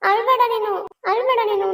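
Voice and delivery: begging her sister not to cry; tearful pleading. Acted. Younger sister begs crying elder sister, tearful and high.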